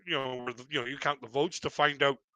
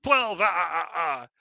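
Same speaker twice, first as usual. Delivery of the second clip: hammed-up Count counting victims; Count from Sesame Street; 2 semitones higher than usual. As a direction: mimic the Count counting with cackles. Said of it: "like the count from freaking Sesame Street".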